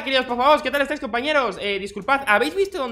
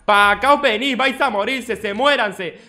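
louder, higher mock-threatening taunt at the zombies; taunting shout; 4 semitones higher, 7 dB louder, slower than usual. Gamer taunting zombies to come at him, shouting. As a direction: yell the dare at the mob.

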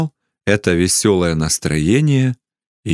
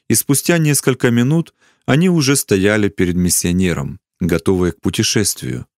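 usual speaking voice

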